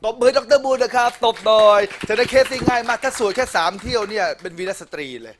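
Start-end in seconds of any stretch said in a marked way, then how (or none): 0.0-4.0 high, loud, cheering the room on; rally the audience to clap; excited call for applause. Real, not acted. Teacher calls for applause. (เรียกเสียงปรบมืออย่างตื่นเต้น)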